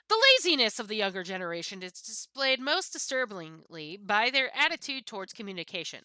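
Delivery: normal talking voice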